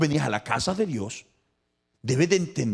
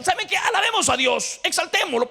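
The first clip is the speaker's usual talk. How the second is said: urgent call to praise; 4 dB louder than usual. Acted. Writer urging people to praise.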